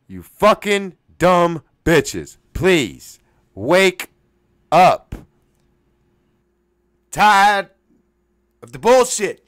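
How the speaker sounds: loud, slow, punched word by word; emphatic angry exhortation. Real, not acted. Scolding listeners to wake up, loud and spaced out. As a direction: hit each word hard, with pauses.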